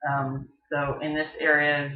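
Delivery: ordinary talk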